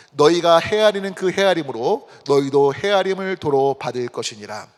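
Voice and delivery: measured, formal recitation of a Bible verse; scripture reading. Acted. Preacher leading congregation in reading Luke, solemn recitation. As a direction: read the verse out deliberately for all to follow.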